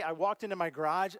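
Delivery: normal talking voice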